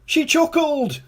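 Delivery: high, loud, anguished king voice; king crying out. A narrator's reading. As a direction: complain through tears, pitched high. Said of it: "cried the king".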